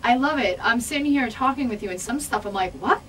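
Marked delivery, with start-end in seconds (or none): 2.7-3.0 mock-baffled, high questioning voice. American guest lost by English slang, mock bafflement. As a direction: act out not understanding, pitched up.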